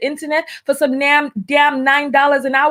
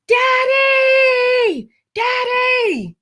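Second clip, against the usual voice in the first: put-on girl screaming Daddy; 10 semitones higher, much slower than usual. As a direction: shriek for Daddy like a little girl in a fight. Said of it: "one of the little girls screamed out".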